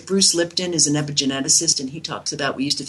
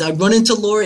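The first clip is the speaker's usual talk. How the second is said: put-on a light New York accent, a bit higher; mild New York accent; 5 semitones higher, 3 dB louder, faster than usual. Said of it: "he, in his New York accent".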